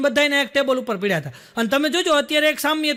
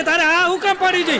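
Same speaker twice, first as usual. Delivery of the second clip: higher-pitched acted protest; hammed-up reproach; mock indignant scolding; 5 semitones higher, 4 dB louder than usual. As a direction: demand an explanation, voice raised in pitch. Phone's owner scolded for saying yes.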